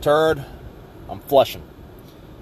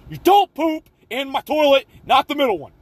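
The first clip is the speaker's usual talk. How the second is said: imitating a mad friend, pitched far higher; mock outrage; 12 semitones higher than usual. Friend scolding him for using his toilet, mock outrage in a high squeal. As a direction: squeal it like an offended buddy. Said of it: "he gets super mad".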